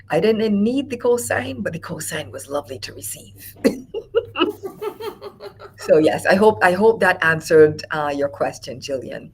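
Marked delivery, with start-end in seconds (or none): 3.6-5.7 bright high laughter. Real, not acted. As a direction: laugh it off, delighted. Laughing at the cosign.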